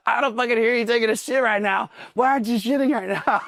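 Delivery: high, strained DI yell through laughter; mock drill instructor yell. Drill instructor yelling at recruit on toilet, mock yell. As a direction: holler it like a drill sergeant.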